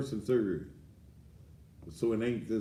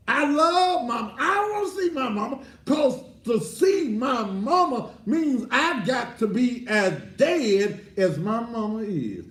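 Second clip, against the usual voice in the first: high, fast, climbing to a shout; heated outburst; 9 dB louder than usual. Acted. Preacher insisting he doesn't want to die yet, heated and high. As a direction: blurt it out, worked up and defiant.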